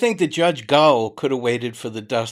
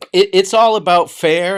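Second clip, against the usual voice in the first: emphatic; 6 semitones higher, 10 dB louder than usual. Real, not acted. Stressing fairness to his viewers.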